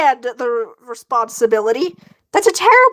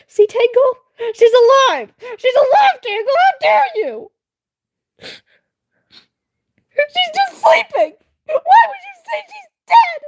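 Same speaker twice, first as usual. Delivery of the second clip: indignant high shouting; 13 semitones higher than usual. Real, not acted. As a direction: scold the chat at the top of your voice. Scolding chat about sleeping cat.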